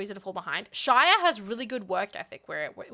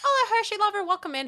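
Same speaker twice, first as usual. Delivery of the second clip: excited greeting; 11 semitones higher, 4 dB louder than usual. Real, not acted. Greeting a viewer by name.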